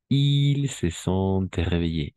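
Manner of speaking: slow, drawn-out model sentence. Shown as a demonstration. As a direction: stretch it so they catch every sound. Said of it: "usted se ha despertado". ("Ils se sont réveillés" muy lento)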